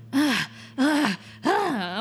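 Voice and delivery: imitating a man's climax grunts; repeated mock moans; hammed-up moans of a man about to bust; mock moaning. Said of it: "make a lot of noise".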